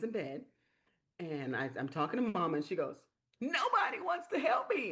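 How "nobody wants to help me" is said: high, tearful mock wail; imitating her crying. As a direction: imitate a caller breaking into tears, pitched high. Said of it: "And she starts crying".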